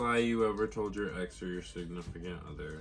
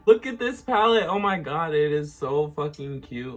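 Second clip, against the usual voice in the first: excited; 6 semitones higher, 10 dB louder than usual. Real, not acted. Excited over new palette.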